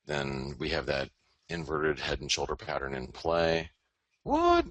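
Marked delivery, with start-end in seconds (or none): 4.2-4.7 very high, loud squealed disbelief; squeaky mock surprise. Mocking his dollar call in a squeaky voice. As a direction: shriek it like a startled cartoon.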